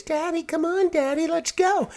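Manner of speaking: put-on high, whiny little kid pleading; whiny child voice. His kids begging Daddy to leave the store, high and whiny. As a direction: pester like a bored child at the checkout.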